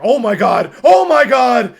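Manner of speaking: Greg Olsen. Retold or imitated. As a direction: yell like a stunned game commentator. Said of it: "probably did this best. He's like".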